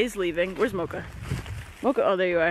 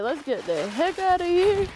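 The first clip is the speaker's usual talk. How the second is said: a light, higher pretend-dog voice; voicing the dog; 8 semitones higher than usual. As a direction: speak for the pet, lightly higher. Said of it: "He's like".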